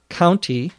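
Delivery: county with the T clearly sounded; careful T. Shown as a demonstration. Teacher introducing the word to listeners, careful T in county. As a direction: say county carefully, keeping the T after the N. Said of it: "slow and carefully pronounced speech".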